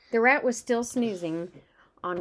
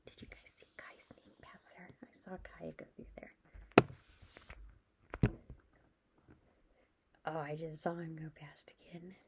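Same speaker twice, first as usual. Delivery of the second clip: real hushed whisper, very soft; barely audible secretive aside; 4 semitones lower, 11 dB quieter than usual. Real, not acted. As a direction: whisper an aside so nobody else hears. Hushed aside about child sneaking past.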